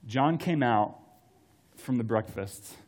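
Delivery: normal talking voice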